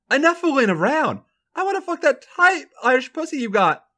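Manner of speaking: high, pushy character voice; Charlotte's voice. A narrator's reading. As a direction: read it as a brash, eager woman. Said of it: "Charlotte says".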